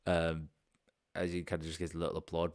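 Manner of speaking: normal talking voice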